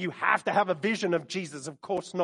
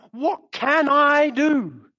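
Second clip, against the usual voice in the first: anxious believer; 5 semitones higher, 6 dB louder, much slower than usual. Pretend. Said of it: "it's like".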